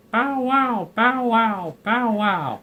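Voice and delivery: high squawking parrot barking "bow wow". Acted. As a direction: screech it like a parrot faking a dog. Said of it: "squawked Tilly".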